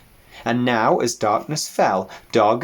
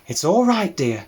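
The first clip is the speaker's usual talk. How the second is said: calm the frightened piglet gently; soothing; 7 semitones higher than usual. Acted.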